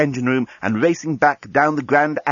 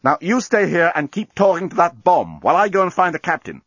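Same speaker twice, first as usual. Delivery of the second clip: firm, higher-pitched orders; firm lawyer speak; 7 semitones higher than usual. A narrator's reading. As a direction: lay it down like a lawyer. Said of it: "firmly in her best lawyer speak".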